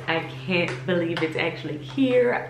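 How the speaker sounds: ordinary talk, nothing marked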